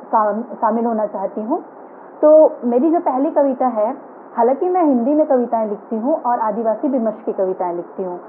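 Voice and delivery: ordinary talk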